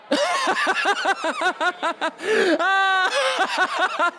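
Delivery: a long high cackle at his own joke; high cackling laugh. Real, not acted. Comic laughing at his own bit, high cackle. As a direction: cackle up high, delighted with yourself.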